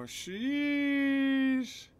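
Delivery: high, drawn-out sheesh; excited sheesh. Real, not acted. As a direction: stretch it out, hyped. Hype reaction to giveaway winner.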